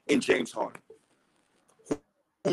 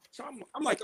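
normal talking voice

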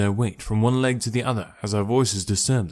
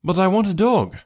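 higher, louder cry; cried out; 8 semitones higher, 4 dB louder than usual. Acted. Narrator pleading up at the window, crying out higher and louder. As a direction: call up insistently at the window. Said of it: "I cried".

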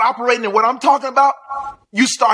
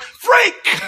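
much higher, sudden shout of Freak; shouted word; 13 semitones higher than usual. Real, not acted. Preacher answering a critic, shouting the word. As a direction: bark it back defiantly.

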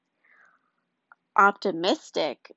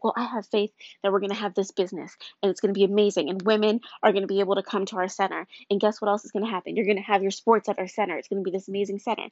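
rushed; faster than usual. Retold or imitated. Rushed mock optimistic self-talk.

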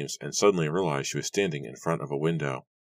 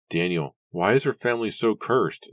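desperate cry; 6 semitones higher, 4 dB louder than usual. A narrator's reading. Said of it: "Nora cried as she ran".

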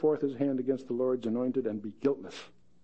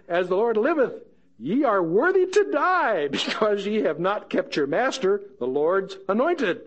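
higher, slow mock-solemn verdict; David condemning; 5 semitones higher than usual. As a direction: pronounce the sentence with relish.

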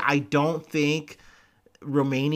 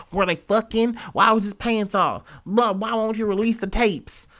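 raised, insistent mock outcry; mock demand; 8 semitones higher, 4 dB louder than usual. Commentator mimicking the public demanding the tapes, raised mock outcry. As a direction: voice the crowd demanding answers, pitch up and louder.

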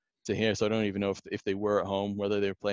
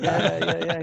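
eager yeah; 7 semitones higher, 5 dB louder than usual. Retold or imitated. Son answering excitedly.

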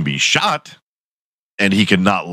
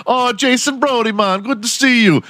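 put-on high, friendly Jamaican-accented greeting; mock Jamaican accent. Villager greeting Jason in a mock Jamaican accent. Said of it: "They have Jamaican accents".

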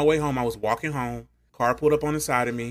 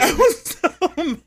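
laughing; 14 semitones higher, 3 dB louder, slower than usual. Real, not acted. Laughing retelling of anger.

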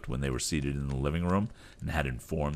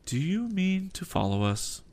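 ordinary delivery, nothing marked

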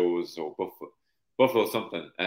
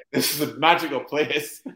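laughing delight; 7 semitones higher, 4 dB louder than usual. Retold or imitated. Recalling delight at the shots.

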